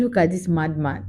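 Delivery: low, quiet aside; muttered disbelief. Retold or imitated. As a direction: say it under your breath, unimpressed. First take, muttered disbelief.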